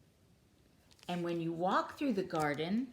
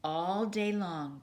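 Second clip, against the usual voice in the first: ordinary delivery, nothing marked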